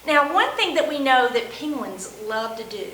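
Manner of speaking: ordinary talk, nothing marked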